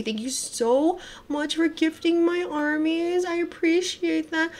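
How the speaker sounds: high, soft, drawn-out thanks; grateful gushing. Real, not acted. Streamer thanking Anon for gifting, grateful gushing. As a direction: coo your thanks, sweetly and slowly.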